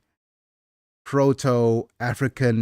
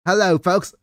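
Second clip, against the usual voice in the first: Tony voice; 7 semitones higher, 4 dB louder than usual. Acted. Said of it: "Let's just go full Tony".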